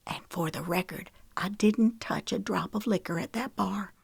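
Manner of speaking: barely holding it together; hushed and fragile; tearful whisper. A narrator's reading. Tearful confession after kidnapping.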